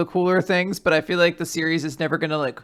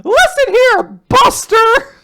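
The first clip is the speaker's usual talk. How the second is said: much higher, louder mock scold; mock scolding parent; 8 dB louder, slower than usual. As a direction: play a fed-up parent. Hosts riff as scolding parents.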